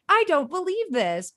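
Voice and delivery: high, incredulous mock disbelief; reacting to an implausible romance plot, mock disbelief. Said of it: "I'm like".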